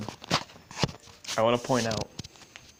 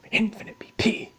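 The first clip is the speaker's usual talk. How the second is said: high, incredulous outburst; mock disbelief; 9 semitones higher than usual. Player hearing of infinite B P, high incredulous mock shock. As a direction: blurt it back in comic shock. Acting out shocked reaction to stats.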